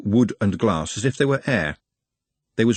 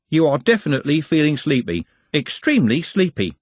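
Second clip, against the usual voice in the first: higher-pitched, insistent suggestion; amateur hypnotist; 6 semitones higher than usual. Acted. Trev swings the can to put Nutt to sleep, hypnotist patter. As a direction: coax the patient towards sleep.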